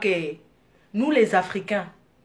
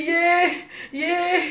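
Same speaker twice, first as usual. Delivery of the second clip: higher, louder laughing wail; mock panicked cry, half laughing; retold wail; 6 semitones higher, 4 dB louder, much slower than usual. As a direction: wail like you think you'll die. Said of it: "J'ai dit".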